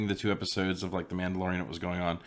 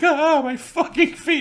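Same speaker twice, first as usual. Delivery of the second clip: mock whining Luke; 9 dB louder, slower than usual. Luke complaining about lost feet.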